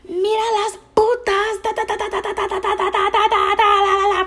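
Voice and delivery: high-pitched mock ranting in Spanish gibberish; imitated screaming. Wife screams insults at the girls in the pool, high mock rant. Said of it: "fucking screaming all this shit".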